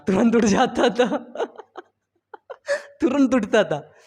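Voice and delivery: laughing through the words; real chuckle while retelling. Real, not acted. Teacher laughs remembering waking for sweets, laughing.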